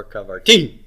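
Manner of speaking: kavarti with a punched, rising final TI; stress on last syllable. Shown as a demonstration. Teacher offers options for where the accent goes, kavarti stressed on the last syllable. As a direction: throw the accent onto the end of kavarti, kavar-TI. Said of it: "Where's the accent go on this?".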